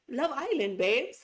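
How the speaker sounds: high, loud mock English accent; laughing English-accent catchphrase; Monica. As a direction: imitate a friend's put-on English accent. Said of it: "When Monica kept saying".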